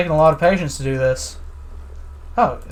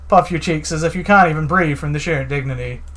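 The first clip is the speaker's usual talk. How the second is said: ordinary delivery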